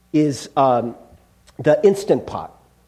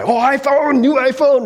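excited crowd of gadget fans, pitched up; 6 semitones higher, 4 dB louder than usual. Acted. Fans outside Best Buy at a midnight release, excited. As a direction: gush like fans at a launch. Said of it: "They'd be so excited".

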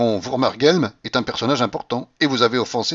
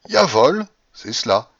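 drawled; 2 semitones higher, 4 dB louder, slower than usual. A narrator's reading. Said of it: "traînai-je en continuant à le regarder".

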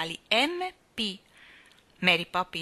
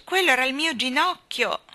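loud, higher indignant complaint; raised voice, full of reproach; reproachful; 6 semitones higher, 6 dB louder than usual. A narrator's reading. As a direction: blame her for the hurt. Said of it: "piena di rimprovero".

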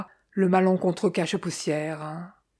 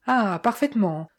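usual speaking voice

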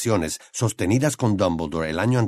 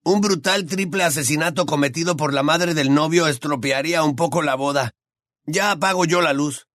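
put-on higher teasing boy's voice; higher young wizard voice; Ron's voice; 6 semitones higher than usual. A narrator's reading. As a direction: voice the gangly friend, amused.

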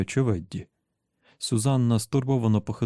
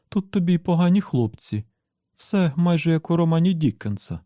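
high-pitched, lively woman's voice; exclaiming; 8 semitones higher, 3 dB louder than usual. A narrator's reading. Susannah reacts to the villains' arrival, high lively exclamation. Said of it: "вигукнула Сюзанна".

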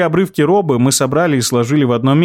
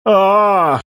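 high anguished ghost cry; sobbing ghost voice; 8 semitones higher than usual. Acted. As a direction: wail like a trapped spirit. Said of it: "застонал призрак".

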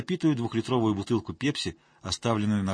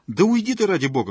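higher, louder angry outburst; exploding in anger; 8 semitones higher, 7 dB louder than usual. A narrator's reading. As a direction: blow up and shout her away. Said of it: "взорвалась Ира".

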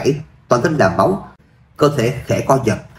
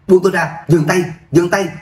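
desperate shouting; 6 semitones higher than usual. A narrator's reading. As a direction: yell for them to stop. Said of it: "Từ Thúc Bá hét lên".

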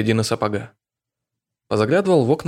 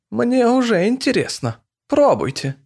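put-on coquettish old woman; 11 semitones higher than usual.

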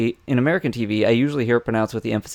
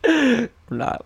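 much higher, playful squeak of denial; squeaky mock denial; 12 semitones higher than usual. Joking to co-host about a hiatus, squeaky mock denial. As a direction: pretend innocence, voice jumping up high.

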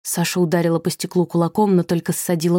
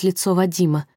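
usual speaking voice

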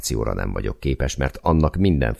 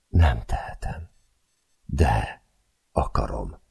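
unfamiliar voice; slower than usual. Acted. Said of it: "Olyan hangon beszél, ahogy még sosem hallottam".

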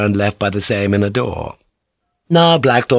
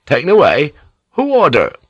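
high, louder disbelieving questions; shocked questioning; 6 semitones higher, 5 dB louder than usual. Acted. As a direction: react in alarm, pitch leaping up. Shocked that guards are taken away.

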